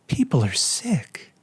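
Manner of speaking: slow, hushed creepy whisper; ghostly slow whisper; eerie whisper. Retold or imitated. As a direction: whisper it like a haunted stranger. Creepy stranger in nightmare.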